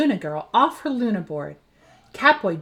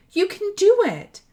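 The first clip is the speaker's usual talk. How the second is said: encouraging; 11 semitones higher, 5 dB louder than usual. Acted. Encouraging friend.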